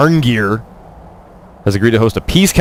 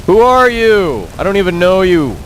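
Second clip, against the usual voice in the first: hammy villain rant; 11 semitones higher, much slower than usual. Pretend. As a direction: ham it up like a villain. Ranting at enemies in game.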